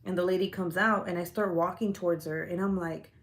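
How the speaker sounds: ordinary talk, nothing marked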